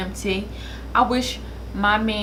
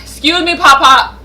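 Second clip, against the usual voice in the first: shouting interruptions; 11 semitones higher, 15 dB louder than usual. Real, not acted. Shouting over the prayer.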